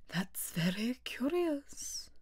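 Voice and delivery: slow, soft turtle murmur; long-breathed wonder. A narrator's reading. Mock Turtle marvelling at the mixed-up poem, slow and soft.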